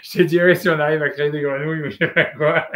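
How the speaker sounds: chuckling through the words; amused, giggling delivery; laughing. Real, not acted. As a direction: laugh while you joke about it. Joking about creating frogs.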